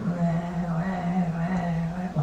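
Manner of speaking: high mock baby wail, pushed soft. Speaker mimics friend's son bouncing on lap, mock baby wail. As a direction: play a crying infant, pitched high. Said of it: "like this".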